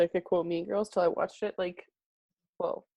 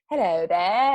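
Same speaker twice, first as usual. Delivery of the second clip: louder film-style British greeting; British accent; 7 dB louder, slower than usual. Retold or imitated. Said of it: "films that are with British accents".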